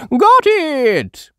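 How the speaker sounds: high, loud triumphant; cheering. A narrator's reading. Granny Pig cheering as she grabs the hat. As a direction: cheer as you grab it. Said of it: "she cheers".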